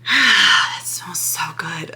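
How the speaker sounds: breathy whispered longing, half a sob; craving whisper. Retold or imitated. Remembering a cigarette craving, she whispers with longing. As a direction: whisper it like you ache for one. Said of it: "and I would just go".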